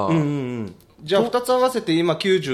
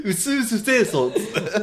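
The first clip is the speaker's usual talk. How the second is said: mock Tohoku dialect; 3 semitones higher, slower than usual. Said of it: "なんでそのズーズー弁で喋るんですか".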